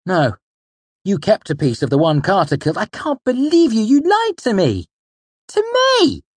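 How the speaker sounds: loud voice climbing to a high cry; outraged accusation. A narrator's reading. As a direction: build from shocked refusal to shrill betrayed protest. Outraged at being lied to.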